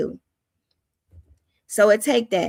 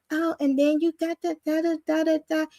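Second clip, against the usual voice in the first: a lightly put-on polished office voice, pitched up; mock the prim professional tone, lightly; proper professional voice; 5 semitones higher, 3 dB quieter than usual. Mocking her own earlier proper video voice. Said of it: "I'm talking professional, proper".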